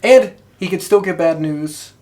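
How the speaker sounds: ordinary talk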